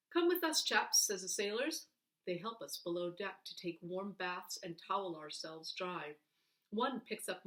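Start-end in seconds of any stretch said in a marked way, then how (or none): 0.1-1.0 cheerful sailor. Acted. Sailors calling boys.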